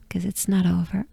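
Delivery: ordinary talk, nothing marked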